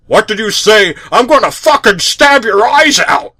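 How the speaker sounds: a loud furious father; angry. A narrator's reading. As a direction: snarl it at your son in rage. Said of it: "said Stan angrily".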